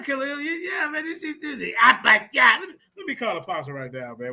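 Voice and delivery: ordinary delivery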